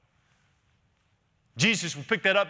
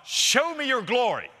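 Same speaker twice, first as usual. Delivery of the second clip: high, raised-voice plea to God; preacher voicing a fervent demand; impassioned plea; 9 semitones higher, 4 dB louder than usual. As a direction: call out boldly, pitch soaring. Acts out Moses' plea to God.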